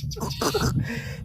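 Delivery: high, breathy laugh; quiet high-pitched burst of laughter; real laugh. Real, not acted. Man laughing off doubts about his origins, high breathy laugh.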